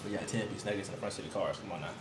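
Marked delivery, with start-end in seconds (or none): none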